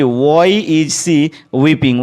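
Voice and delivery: very slow, word by word, clearly spaced; slow dictation. Shown as a demonstration. Teacher dictating to the class, very slow and clear. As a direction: spell it out slowly for students to write. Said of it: "teacher dictates the English sentence".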